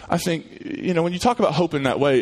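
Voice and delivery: normal talking voice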